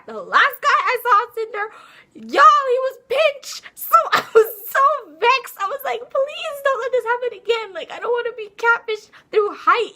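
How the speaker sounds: loud high outrage, laughing through it; exasperated rant. Real, not acted. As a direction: wail about being catfished. Ranting about the Tinder guy.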